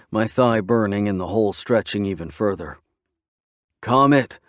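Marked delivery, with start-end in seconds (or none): 3.8-4.4 loud, high, pained shout. Acted. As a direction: shout her name with all you have. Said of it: "I somehow manage to shout".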